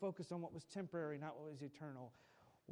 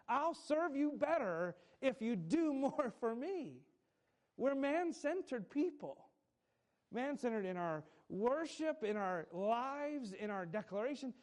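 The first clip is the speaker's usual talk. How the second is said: raised, slower preaching at full force; fervent pulpit delivery; 6 semitones higher, 5 dB louder than usual. Acted. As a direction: preach it hard to the room. Preaching climax to congregation.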